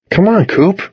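high-pitched, pleading urge; urging. A narrator's reading. Boy tugging dog's leash before being seen, urging. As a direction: coax the stubborn dog along. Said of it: "I urged".

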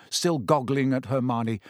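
ordinary delivery

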